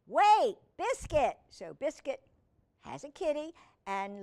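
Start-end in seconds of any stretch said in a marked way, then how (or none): 0.1-1.4 high, loud call to halt. Acted. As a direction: shout after a puppy to stop. Girl calls to stop puppy.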